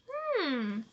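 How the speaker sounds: ordinary delivery, nothing marked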